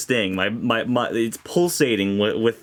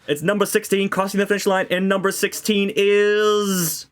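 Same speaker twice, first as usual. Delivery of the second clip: race announcer; 7 semitones higher than usual. Pretend. Race call parody.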